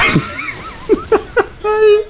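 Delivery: squealing, very high laughter; high giggling laugh. Real, not acted. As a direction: laugh helplessly in a high pitch. Laughs at the reveal.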